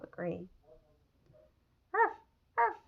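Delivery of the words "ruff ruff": high yapping little-dog bark. Acted. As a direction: bark like a small puppy, high and loud. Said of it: "Webby barks".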